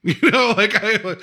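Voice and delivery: high, laughing through the words. Real, not acted. Host cracking up at his own quip about the mom's age. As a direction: break into a laugh mid-sentence.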